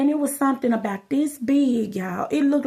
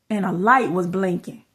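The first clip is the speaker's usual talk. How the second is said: usual speaking voice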